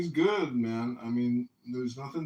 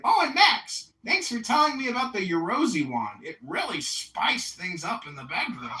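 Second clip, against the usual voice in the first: mock dad voice; 6 semitones higher than usual.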